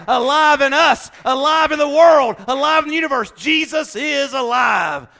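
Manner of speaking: rising, shouted preacher's climax; triumphant preaching shout; preaching at full force. Acted. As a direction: build the crowd up to a roar.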